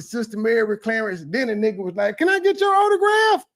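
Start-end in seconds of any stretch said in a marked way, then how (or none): 2.2-3.4 much higher and louder, starstruck fan; excited fan shout. Retold or imitated. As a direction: yell it like an eager fan begging. Fan asking autograph.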